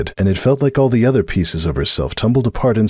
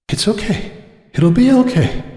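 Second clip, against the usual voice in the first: high, trembling self-reassurance; Cupcake's high voice; 13 semitones higher than usual. A narrator's reading. Cupcake steadying herself before Carrick, high and strained. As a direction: talk herself down, voice pitched high.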